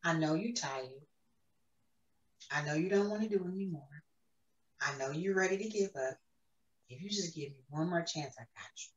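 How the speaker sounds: hushed, tender reassurance; God's voice, soft and low in volume. Retold or imitated. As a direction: speak very softly to someone worn out. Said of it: "Then he said, very soft".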